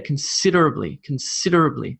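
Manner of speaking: considerably as con-SID-erably, falling; considerably stressed on the second syllable; emphasis on SID. Shown as a demonstration. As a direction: hit the S sound of SID in considerably. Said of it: "especially on this s sound".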